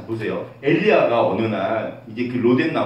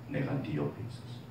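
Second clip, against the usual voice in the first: voice of the Lord; 12 semitones lower, 13 dB quieter than usual. Retold or imitated. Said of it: "주님이 그러시더라고요".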